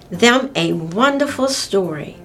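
ordinary delivery, nothing marked

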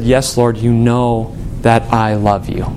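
low, slow, subdued admission; humbled, ego gone. Acted. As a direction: answer humbly, without any show. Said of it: "He just said".

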